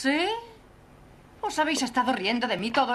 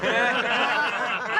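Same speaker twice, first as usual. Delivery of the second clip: scornful, loud laugh; bitter laugh; 4 dB louder than usual. Acted. Molly scorning the laughing reporters, bitter laugh. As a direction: laugh back at them in contempt.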